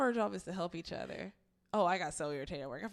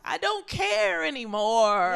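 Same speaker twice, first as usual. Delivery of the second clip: fed-up retort; 8 semitones higher, 11 dB louder, much slower than usual. Retold or imitated. Retorting to disrespect at work.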